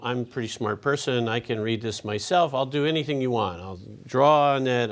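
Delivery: ordinary talk, nothing marked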